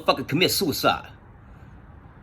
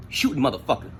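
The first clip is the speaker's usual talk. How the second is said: ordinary talk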